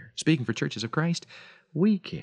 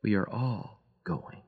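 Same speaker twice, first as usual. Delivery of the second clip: soft, slow murmur, much softer; murmured softly; 9 dB quieter, slower than usual. Acted. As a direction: murmur it, fading, near the end. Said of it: "He murmured softly".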